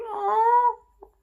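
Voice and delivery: high, loud wail of "oh"; loud sobbing oh; crying out. Retold or imitated. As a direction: cry out in heartbroken sympathy.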